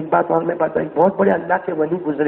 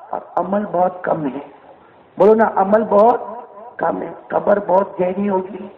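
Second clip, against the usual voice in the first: ordinary delivery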